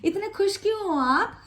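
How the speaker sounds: high, wondering ask; curious question. Retold or imitated. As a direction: sound genuinely curious. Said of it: "पूछते हैं ना". (ऊँची, जिज्ञासु आवाज़ में सवाल)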